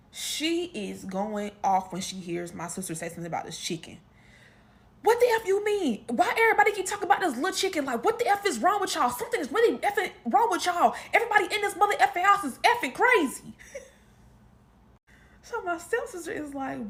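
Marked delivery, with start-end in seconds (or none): 5.0-13.5 loud, fast angry tirade, higher pitched; retold furious rant. Sister going off at the family, loud angry rant.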